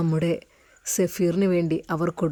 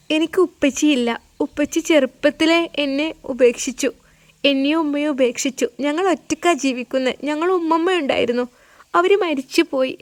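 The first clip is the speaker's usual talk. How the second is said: put-on little girl's voice; 7 semitones higher than usual. Little girl telling Safeer she has no father, childlike voice. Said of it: "സഫീറിൻ്റെ കൈകൾ പിടിച്ചുകൊണ്ട് പറഞ്ഞു".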